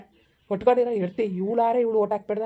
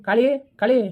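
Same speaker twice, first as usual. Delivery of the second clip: raised, sharp demand at his wife; angry scolding; 4 semitones higher than usual. Acted. Husband confronting wife Kali, angry raised voice. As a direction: call her out and confront her hard.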